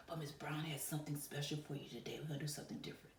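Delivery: hushed secret whisper. Acted. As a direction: whisper the surprise to build suspense. Teacher builds suspense.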